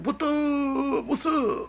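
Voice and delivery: high, loud mock squeal of surprise; squealed greeting, hammed-up; excited reunion greeting. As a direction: re-enact bumping into an old friend, drawn out and high-pitched. Re-enacts surprised airport reunion greeting.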